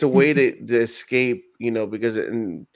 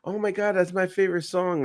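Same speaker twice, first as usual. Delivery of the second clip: high, gushing put-on voice; squealing fan, much higher; gushing customer; 7 semitones higher, 4 dB quieter than usual. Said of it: "somebody's like".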